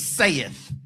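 "saith" as SAY-eth, two beats; King James "saith"; saith said the old way. Shown as a demonstration. For the flock, "saith" like King James. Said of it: "unless he says, "saith".

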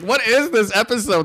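high-pitched disbelief; baffled. Retold or imitated. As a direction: ask it like you can't believe it. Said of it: "And I'm like".

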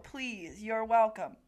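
ordinary delivery

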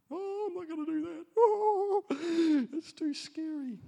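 high, whiny fake-crying refusal; mock whimper. Preacher mocks his own reluctance, high whiny mock crying. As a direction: whimper like a scared child dodging the task. I'm in that category.

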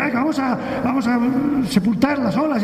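high, grumbling complaint on waking; grumpy just-woken Jesus. Retold or imitated.

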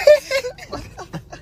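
high helpless laugh; cracking up, pitch jumping; real laughing fit; high burst of laughter. Real, not acted. Breaks down at the joke.

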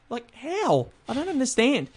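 high-pitched incredulous complaint; baffled. Real, not acted. Host griping at misread names, baffled. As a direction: protest in disbelief, pitch way up.

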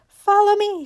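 high, loud rallying call; eager cry. A narrator's reading. Raggedy Ann leading the other dollies, high eager cry. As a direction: call out high for them to follow. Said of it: "she cried".

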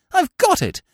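plain reading voice

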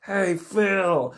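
high, drawn-out mock holler; calling out. Kids hail classmate among scarecrows, high mock call. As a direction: call to someone far away.

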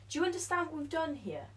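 ordinary talk, nothing marked

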